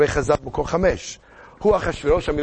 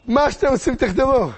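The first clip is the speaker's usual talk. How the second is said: high-pitched, raised royal outburst; exclaimed decree; 10 semitones higher, 4 dB louder than usual. Acted. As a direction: grant it with a big cry. King grants permission to write. (הכרזת המלך בקול גבוה)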